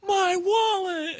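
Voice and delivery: high, slow squeal of joy; delighted cry. Retold or imitated. Man in the ad squealing over his lost wallet. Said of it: "and he goes".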